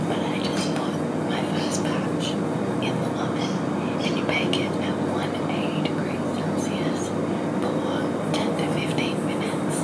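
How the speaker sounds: hushed whisper with friends asleep. Real, not acted. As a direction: whisper so nobody wakes. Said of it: "I have to whisper because".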